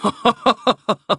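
staccato, high haughty chuckle; hearty mock laugh from a carefree character; character's laugh. Riefel laughing in narrated scene.

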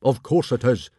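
loud, blustering chief; big booming father voice; Stoic. A narrator's reading. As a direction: dismiss it loudly, full of certainty. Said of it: "said Stoic".

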